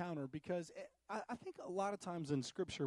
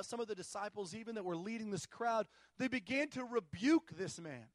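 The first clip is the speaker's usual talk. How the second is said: normal talking voice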